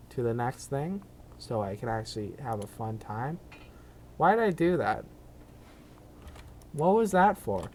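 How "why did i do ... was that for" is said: louder, higher exasperation; exasperated. Real, not acted. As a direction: scold yourself loudly. Exasperated at his own move.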